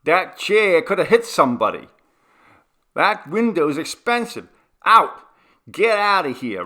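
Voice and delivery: loud, high angry tirade; enraged rent collector evicting the tenant; furious shouting. Acted. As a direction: throw him out, yelling.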